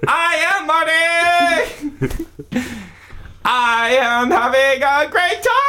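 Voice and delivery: very high, giddy monster voice; squeaky Marty voice. Acted. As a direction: squeal with delight.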